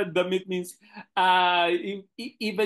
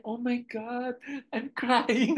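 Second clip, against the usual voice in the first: moved designer; 8 semitones higher, slower than usual. Retold or imitated. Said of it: "Sabi niya".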